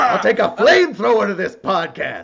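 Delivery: loud, intense movie tough guy; Al Pacino impression. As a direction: growl it like a Pacino rant. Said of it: "old school Al Pacino".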